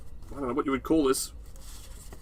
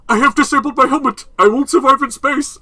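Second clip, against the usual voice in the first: high, loud drive-thru speaker voice; 10 semitones higher, 10 dB louder than usual. Retold or imitated. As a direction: blare it like a fast food intercom. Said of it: "very loud McDonald's drive-thru voice".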